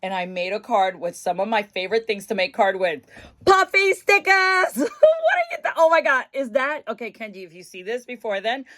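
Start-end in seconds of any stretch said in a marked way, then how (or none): 3.4-6.2 thrilled reveal; excited. Real, not acted. Showing off the card.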